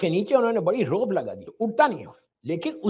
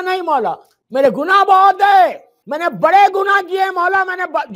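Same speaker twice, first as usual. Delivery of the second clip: anguished cry; 8 semitones higher, 10 dB louder than usual. Acted. The weeping sinner cries out his sins to Ali. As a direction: cry out your guilt in despair.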